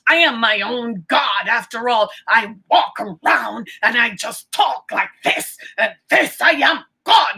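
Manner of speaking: lightly voiced smug self-worshipper, mostly in own voice; mocking arrogance. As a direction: mimic the proud crowd who think they are God. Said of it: "That's how we act".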